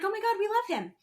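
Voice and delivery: high, gushing squeal of delight; gushing fans. Retold or imitated. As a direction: gush over him like a smitten fan. Fans gushing over him.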